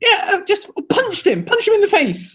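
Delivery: high, giggly suggestions; laughing. Real, not acted. Co-host joking about other options, laughing. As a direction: talk through rising laughter.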